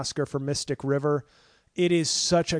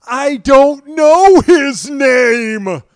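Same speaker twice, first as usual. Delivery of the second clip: high, very loud, slow stunned line; dazed Jeff Bridges; 11 semitones higher, 16 dB louder, much slower than usual. Retold or imitated. Father answering the nurse, high, loud and stunned. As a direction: let it drag out in shock. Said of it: "he looks at her and goes".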